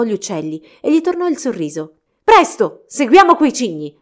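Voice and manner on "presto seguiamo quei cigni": loud, high urgent cry; shouting. A narrator's reading. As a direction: rouse friends to the chase. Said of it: "gridò saltando in piedi".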